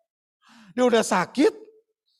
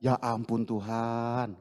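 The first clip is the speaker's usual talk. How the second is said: pleading sigh; 10 semitones lower, 8 dB quieter, slower than usual. Acted. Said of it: "Ini dalam bahasa sehari-hari".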